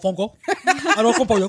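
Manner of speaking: put-on rolling out Spanish food names in a Spanish accent. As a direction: say it like a proud Spanish speaker. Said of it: "It says".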